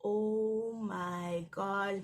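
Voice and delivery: slow, drawn-out oh my God; shocked and slow; hushed, drawn-out shock; stunned disbelief. Retold or imitated. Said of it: "we both were like".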